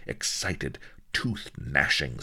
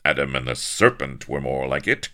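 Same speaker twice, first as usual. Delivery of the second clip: cold, curt and louder put-down; icy retort; 8 dB louder than usual. Acted. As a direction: drop each word like ice. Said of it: "Avery's words dropped like ice cubes".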